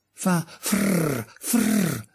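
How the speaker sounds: high rolled frrr hiss, voiced as a little daisy. Daisy imitates the tree hissing, high rolled frrr. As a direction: hiss the frrr like rustling branches. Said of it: "esclamò una margherita".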